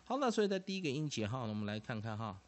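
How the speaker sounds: ordinary talk, nothing marked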